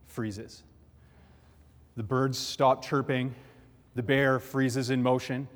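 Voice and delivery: ordinary talk, nothing marked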